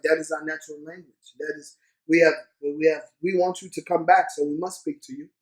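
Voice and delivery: a gentle, matter-of-fact local; lightly voiced Zambian host. Locals explaining to the visitor why they speak English, low and soft.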